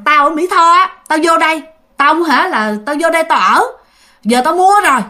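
imitating a mother who won't back down; defiant retort. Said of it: "Cái mẹ hả cũng không vừa".

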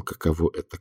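ordinary delivery

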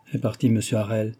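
plain reading voice

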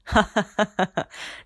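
loud scornful burst of laughter; mocking devil laugh. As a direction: laugh off the threat loudly. Devil chief mocks the threat.

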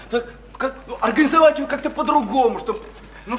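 ordinary talk

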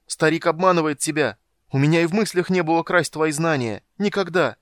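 loud, high, vehement denial; shouted protest. A narrator's reading. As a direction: protest at full voice, pitched high. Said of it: "закричал он".

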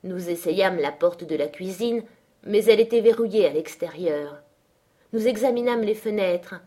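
ordinary delivery, nothing marked

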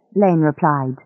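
ordinary delivery, nothing marked

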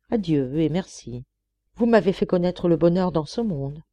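plain reading voice

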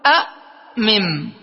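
slow أأمم as a' ... mim; split-up word form. Shown as a demonstration. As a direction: sound out أأمم slowly with a gap after the hamza. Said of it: "فنقول".

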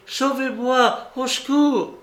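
the aunt imitating his high cry, au secours; a hammed-up call for help; mock cry for help. Aunt mimicking her nephew's cry, high and strained. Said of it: "tu as tout à coup crié".

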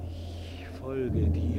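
soft, very slow, drawn out; quiet resolve. Acted. Hamlet softly vowing to follow the ghost. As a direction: speak it slowly like a vow.

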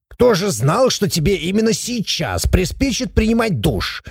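high-pitched, whiny protest; put-on, squawky indignation; indignant dwarf. A narrator's reading. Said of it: "возмутился Абаддон".

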